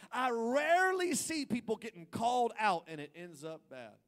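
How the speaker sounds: loud and high, preaching hard; full-force preaching. Acted. Pastor preaching loudly to the congregation. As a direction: build the point like a revival preacher.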